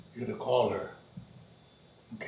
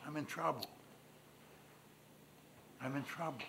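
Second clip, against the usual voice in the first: quiet plea; 3 semitones higher, 11 dB quieter than usual. Acted. Hushed plea.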